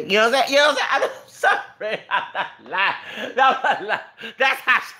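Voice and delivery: high-pitched, breaking into laughter; cracking up. Real, not acted. Man insisting his story is true, cracking up laughing. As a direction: struggle to get words out while laughing.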